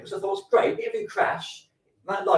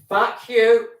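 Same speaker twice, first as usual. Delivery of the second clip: mock shout; 8 semitones higher, 10 dB louder than usual. Check-in worker retold.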